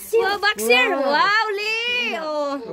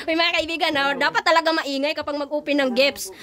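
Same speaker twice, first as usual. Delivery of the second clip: ordinary delivery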